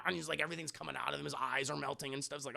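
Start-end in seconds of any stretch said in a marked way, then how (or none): none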